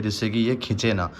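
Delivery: ordinary talk, nothing marked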